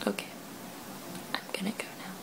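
soft whispered goodbye. Real, not acted. Signing off at 2 AM.

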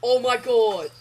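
loud alarmed cries; panicked yelling. Real, not acted. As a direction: cry out as they swarm you. Swarmed by pigmen.

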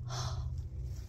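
a soft breathy gasp of awe; whispered gasp. Real, not acted. Seeing the purse, a hushed gasp. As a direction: gasp in quiet wonder.

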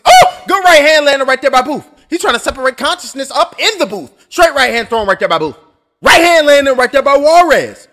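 loud, punchy ringside call; play-by-play call. Acted. Streamer calling the fight live for chat, loud play-by-play.

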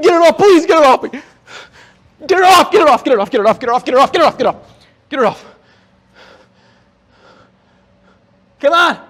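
high panicked pleading shout; frantic, disgusted begging, high and loud; shuddering revulsion. Real, not acted. Huge bug on his back.

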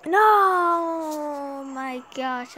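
slow, loud drawn-out groan; anguished cry. Real, not acted. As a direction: cry out at the loss. Just lost the game.

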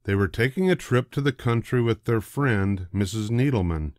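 usual speaking voice

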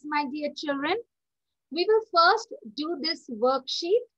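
usual speaking voice

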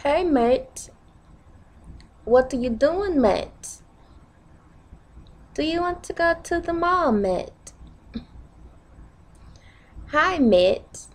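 sing-song attempt at a UK accent. Shown as a demonstration. Vlogger inviting a mate to the mall, UK accent. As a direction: put on a UK accent, swooping up and falling on "mate". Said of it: "So U K accent".

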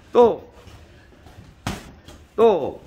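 loud one-word shout; loud bark. Real, not acted. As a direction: bark it like a coach. Coach shouting final push.

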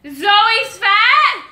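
high, loud questioning shout; shouted incredulous question; screaming. Real, not acted. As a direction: yell the guess, unaware how loud you are. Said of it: "He's screaming at me".

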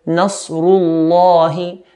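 nasrullahi with a thick, dark, drawn-out ll; slow, full heavy lam in nasrullahi. Shown as a demonstration. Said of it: "make the lam in the word of Allah heavy".

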